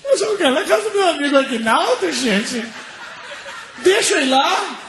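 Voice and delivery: high-pitched whine of desperation; comic pleading. Retold or imitated. Blind passenger begs to visit his friend, whining plea. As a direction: plead like a child near tears, mock-desperate.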